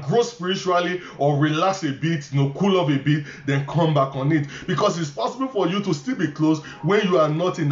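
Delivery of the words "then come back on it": ordinary talk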